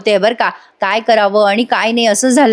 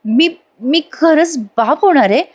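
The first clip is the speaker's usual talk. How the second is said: halting, drawn-out question with a higher pitch; stunned father-to-be, stretching out the words; slow disbelief; 6 semitones higher than usual. Acted. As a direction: let it dawn slowly, pausing between words. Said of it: "असं slow slow बोलतोय बरं का प्रेक्षकांनो आणि अचानक म्हणतो".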